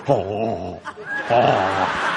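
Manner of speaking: low, drawn-out mock ogling groan; gawking men. Guys watching the lady bump him, low mock swooning. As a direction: imitate dumbstruck men, deep and drawn out. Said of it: "And the guys go".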